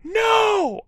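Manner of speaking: very high, drawn-out "no"; high cry. A narrator's reading. Girl running up to screaming Vampire, high-pitched cry. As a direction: squeal it in alarm.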